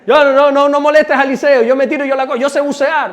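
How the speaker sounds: loud, high, insistent mock bragging; hammed-up man who can handle it himself; self-sufficient men. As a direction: wave off help with overeager confidence. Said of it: "Pero los hombres somos".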